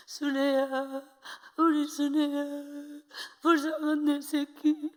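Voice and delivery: very slow, tearful lament; mother crying. Retold or imitated. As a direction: mourn her own child gently. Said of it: "하면서 엄마가 그렇게 울어요". (울면서 애통하게)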